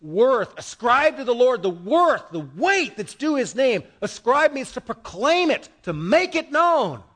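emphatic, drawn-out preaching cadence. Real, not acted. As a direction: proclaim it to the whole congregation.

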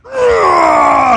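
loud high angry roar at the dog. Retold or imitated. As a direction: let out a big furious yell. Grabbing the dog in anger.

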